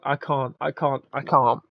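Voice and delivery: ordinary delivery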